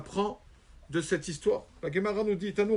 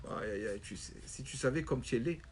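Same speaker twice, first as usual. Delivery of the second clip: low mocking taunt; 6 semitones lower, 9 dB quieter than usual. Rabbi mocks the ugly man.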